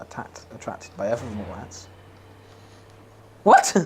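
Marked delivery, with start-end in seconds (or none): 3.4-3.7 high squeaky breaking "What?"; voice crack. Real, not acted. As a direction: voice cracks upward in surprise. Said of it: "Oh, voice crack".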